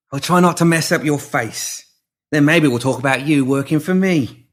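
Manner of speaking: swaggering thug. Acted. Pinky taunting the girl he means to beat, cocky. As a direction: sneer down at a small opponent.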